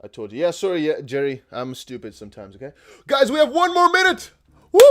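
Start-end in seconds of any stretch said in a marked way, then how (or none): 3.1-4.8 loud, high hype call; excited shout. Real, not acted. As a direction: shout it to the chat, pumped. Hyped countdown call to viewers.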